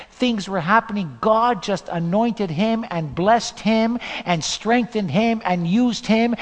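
usual speaking voice

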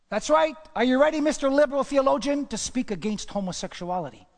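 loud, high taunting call-out; mock challenge. Preacher taunting a liberal theologian, loud and high. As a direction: throw it at the liberal like a dare.

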